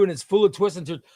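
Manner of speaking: ordinary delivery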